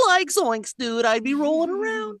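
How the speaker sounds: high, goofy Shaggy voice; Shaggy impression. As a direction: do a stoner cartoon teen. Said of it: "into that mimicry".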